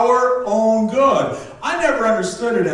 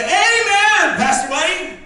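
high loud call of amen; 10 semitones higher than usual. Retold or imitated. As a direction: call it out from the pew. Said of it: "they'll say".

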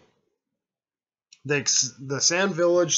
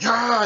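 sudden cry of shock, higher and louder; mock cry; 10 semitones higher, 9 dB louder than usual. The puppet girl cries out when struck, loud yelp. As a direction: yelp as the blow lands.

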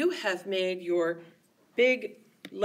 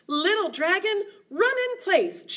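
high, loud, energetic call; excited call; 8 semitones higher, 5 dB louder than usual. Shown as a demonstration. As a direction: pump the kids up.

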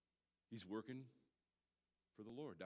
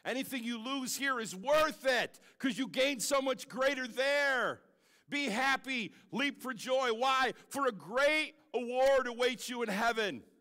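much higher, loud proclaiming; fiery sermon climax; full-force preaching; 14 semitones higher, 15 dB louder, slower than usual. Real, not acted. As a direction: preach it to the back row.

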